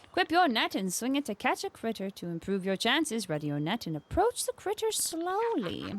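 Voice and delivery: a hint of a Minnesotan accent, voicing a friendly beekeeper; light Upper Midwest lilt with airflow held back. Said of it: "restrict your airflow just a little bit".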